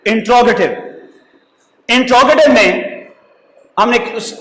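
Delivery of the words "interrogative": interrogative said as "in-TRO-gə-tiv"; taught pronunciation. Shown as a demonstration. Teacher repeats the word for students, interrogative pronounced "in-trogative". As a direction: say interrogative as "intro-gative", without the "ter". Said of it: "लफ्ज को बोलना है".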